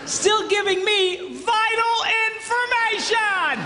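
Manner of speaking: slow, high, drawn-out shout; mock exasperation. Husband amazed at information sent from another floor, slow high shout. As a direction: stretch each word in high comic outrage.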